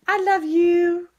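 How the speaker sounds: high, slow cooing. Real, not acted. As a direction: coo it to a pet. Cooing to the dogs.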